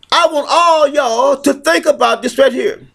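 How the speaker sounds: usual speaking voice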